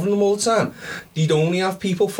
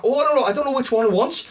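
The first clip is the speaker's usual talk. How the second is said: mock flustered caller; 8 semitones higher than usual. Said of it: "You got".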